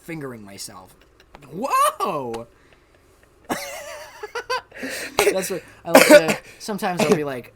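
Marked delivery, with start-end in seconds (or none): 3.5-5.3 high shrieking burst of laughter. Real, not acted. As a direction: crack up hard. Laughing after crude joke.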